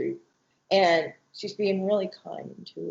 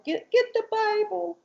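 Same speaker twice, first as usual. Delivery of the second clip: put-on high childlike voice; little girl voice; 13 semitones higher than usual. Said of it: "she's talking like this".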